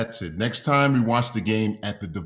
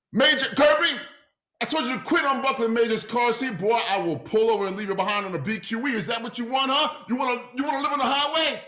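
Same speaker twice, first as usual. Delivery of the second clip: angry parent yell; 11 semitones higher than usual. Acted. Deacon yells at his son in the car, high and angry. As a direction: bark threats at the kid in the back seat.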